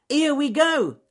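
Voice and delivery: high, loud shriek. A narrator's reading. Alec shrieking his own punchline to the rabbits, loud and high. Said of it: "shrieked Alec Fluffy Rabbit".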